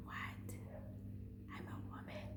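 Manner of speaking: breathy whispered disbelief; shocked whisper. Retold or imitated. Vlogger whispering to herself in mock shock. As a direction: hush it like a shocked secret.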